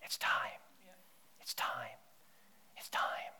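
unvoiced, repeated whisper; soft whispered summons, pause between; whispered voice of God. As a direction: hush each line like a secret call. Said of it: "you'll hear".

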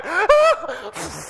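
a laughing high yelp of fake panic; mock panicked yelp. Teller mocks his own panic in the shallow water, laughing yelp. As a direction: squeal it while laughing at yourself. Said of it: "did anybody see this guy go".

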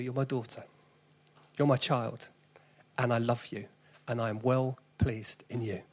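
soft, gentle reassurance; preacher voicing God to each father, tenderly; tender fatherly voice. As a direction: speak it softly, like God to a son. God's voice to women.

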